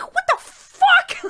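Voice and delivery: very high, loud, stretched-out outraged yell with a laugh. Retold or imitated. Driver nearly hitting [name], outraged laughing yell. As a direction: shriek it in disbelief, half laughing. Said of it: "I was so angry".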